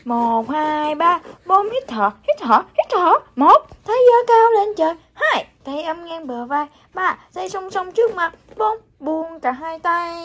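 counting out exercise steps. Acted. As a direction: call the moves like a coach. Leads morning exercise count.

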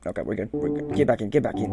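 ordinary talk

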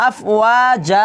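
আফওয়াজা with the ওয়া drawn out one alif long; stretched vowel. Shown as a demonstration. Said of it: "এক আলিফ টেনে পড়তে হবে". (আফওয়াজা এক আলিফ টেনে)

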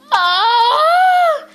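high, loud drawn-out wail. Acted.

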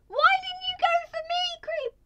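very high, loud accusing yell; furious. Real, not acted. Player yelling at the creeper, high furious accusation. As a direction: shout it at the creeper.

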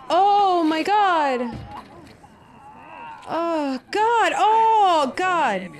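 high, loud, stretched exclamations; horrified cry. Real, not acted. Viewer sees a bloody injury on screen, horrified cry. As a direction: cry out in horror at the sight.

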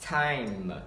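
"time" with long ai, lips shut on the final M; lips closed on M. Shown as a demonstration. Teacher says close the lips, drawn-out time ending in M. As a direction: close "time" firmly into a hum. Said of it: "Âm long I và âm M chúng ta ngậm môi vào".

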